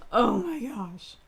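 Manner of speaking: slow, tear-choked gasp; barely holding it together; overcome, teary. Real, not acted. Reader overcome with emotion, teary. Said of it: "I'm not okay".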